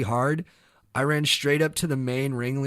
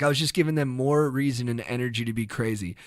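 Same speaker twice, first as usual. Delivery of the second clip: ordinary talk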